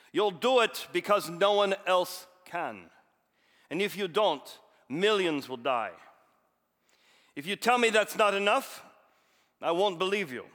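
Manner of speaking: loud, rousing speech in a hammed Russian accent; rally the volunteers in a bad Russian accent. Officer urging the liquidators into the water, loud Russian accent speech. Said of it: "here comes maybe a bad Russian accent".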